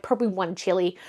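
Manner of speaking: usual speaking voice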